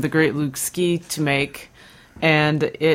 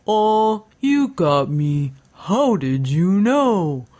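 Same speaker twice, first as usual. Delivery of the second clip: slow, drawn-out goofy confession; dopey character owning up; invented street voice; 3 semitones higher, much slower than usual. Acted. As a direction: stretch each word with exaggerated swooping intonation. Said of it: "then Lawrence Avenue would say".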